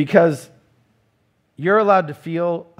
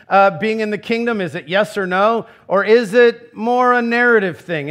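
raised, louder preaching voice, pressing each question; preaching at full force; 5 semitones higher, 4 dB louder than usual. Acted. Preacher rousing the congregation, raised and louder. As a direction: drive the point home to the congregation. Preacher builds through marriage illustration.

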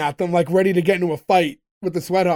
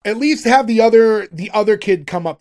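ordinary talk, nothing marked

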